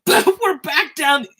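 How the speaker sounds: high, loud cry of astonishment; excited outburst. Real, not acted. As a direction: burst out as the price drops. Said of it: "I will seriously lose my stuff".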